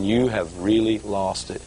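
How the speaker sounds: "You have really lost it" drawn out slowly and low; slow emphatic disbelief. Retold or imitated. Thinking his friend "lost it" over the offering, slow disbelief.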